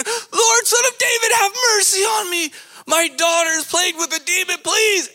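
very high, shouted plea; frantic, full-voiced begging; desperate mother. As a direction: cry out at the top of your voice for your daughter. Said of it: "Can you imagine being this woman?".

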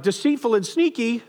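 normal talking voice

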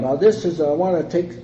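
ordinary talk

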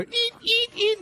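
high, short squeaks; squeaky scooting noises; imitated chair scoot. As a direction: mimic a chair squeaking along the floor. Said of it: "There's a girl kinda".

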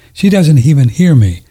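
normal talking voice

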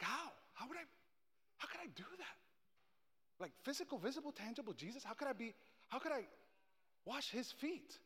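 high, soft, incredulous questioning; wondering how it could be possible; acted disbelief. Voicing a listener's disbelief.

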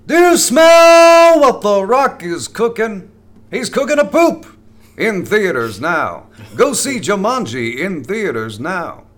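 loud, high wrestling promo, drawn out; The Rock. Acted. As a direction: bellow a slow catchphrase like a showboating wrestler. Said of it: "I practiced my rock impression".

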